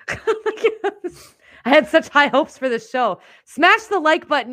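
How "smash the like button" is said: loud outro plea. Real, not acted. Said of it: "outro call to viewers".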